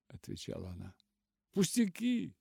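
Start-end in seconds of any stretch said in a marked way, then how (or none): 1.5-2.3 high, breezy cry; cheerful exclamation. A narrator's reading. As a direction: wave it away cheerfully, pitched high. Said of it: "весело вскричал он". (весёлый возглас)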